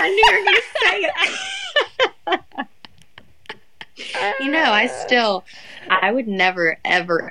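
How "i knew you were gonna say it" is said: high, slow words through real laughter; laughing. Real, not acted. Friend teased about the story, laughing high and slow.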